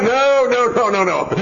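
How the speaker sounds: acted emphatic refusal; refusing mother. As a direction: shut down a child's bargaining. Mother refuses child's plea.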